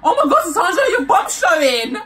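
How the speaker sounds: loud shout. Retold or imitated. Courtney shouting to the whole canteen, laughing. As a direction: yell it across the canteen. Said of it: "she goes out loud".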